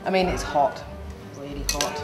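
ordinary talk

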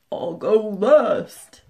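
slow, drawn-out "I'll go last"; lumber it out like a big bus. Acted. Double-decker bus voice in story.

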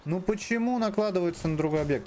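high, pleading complaint; exasperated whine. Real, not acted. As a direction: whine at the game in disbelief. Frustrated at the game.

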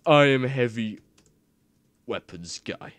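loud opening, then a lightly voiced Heavy; booming start of a mock Heavy intro; reading the intro as Heavy; Heavy Weapons Guy impression. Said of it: "do the Heavy Weapons Guys intro".

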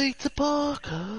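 loud, slow, high British imitation; mock British accent. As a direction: drag it out in exaggerated British. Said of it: "British people watching Spider-Man be like".

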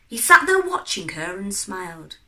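ordinary delivery

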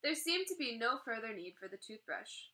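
plain reading voice

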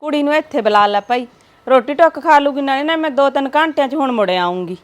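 loud call across the yard; calling out loudly. Acted. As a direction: shout your instructions to the neighbour. Calling out to neighbour.

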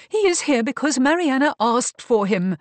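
high, loud protest; urgent protest. A narrator's reading. Beatrice defends Davenport's presence to Marcus, urgently protesting. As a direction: protest urgently over his shouting.

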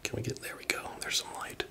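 whispering. Real, not acted. Quiet exploring in abandoned house.

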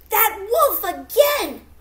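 loud, high, slow alarmed cry; alarmed surprise. Acted. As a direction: gasp it out as you spot him. Betsy spots the wolf.

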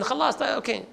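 ordinary talk

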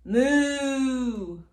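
long, loud falling moo; big stretched-out moo; cow moo. Acted. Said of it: "A cow?".

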